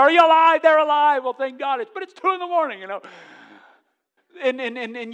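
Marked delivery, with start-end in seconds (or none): 0.0-1.8 high, loud anxious questions; a panicked parent at night, then relief; frantic checking. Retold or imitated. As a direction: rush in breathless with fear. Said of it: "you're running there".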